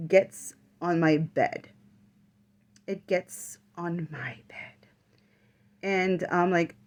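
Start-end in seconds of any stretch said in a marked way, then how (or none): 2.9-4.9 breathless whispered repeat, slowed. Real, not acted. A medium relives the thing climbing on her bed, whispering. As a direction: whisper it as if it might hear.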